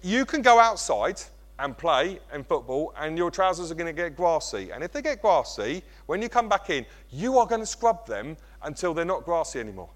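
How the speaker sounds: ordinary talk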